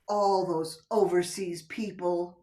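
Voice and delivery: ordinary talk, nothing marked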